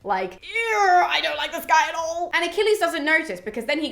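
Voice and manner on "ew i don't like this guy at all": whiny show of dislike; mock disgust. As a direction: act out a put-off river god. Voices annoyed river god reacting.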